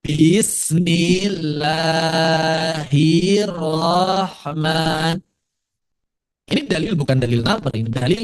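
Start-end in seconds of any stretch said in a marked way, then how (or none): 0.1-5.2 "Bismillahirrahman" very slow, chanted, every vowel stretched; over-lengthened syllables. Shown as a demonstration. Teacher imitates a common misreading, "Bismillahirrahman" chanted overlong. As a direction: chant "Bismillahirrahman" with each syllable held far too long. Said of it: "orang yang suka baca begitu".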